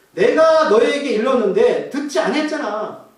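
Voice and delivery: loud, drawn-out exasperated retort; frustrated. Acted. Blind man saying he already told them, exasperated. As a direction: drag out the complaint with force. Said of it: "답답하게 뭐라고 하냐면".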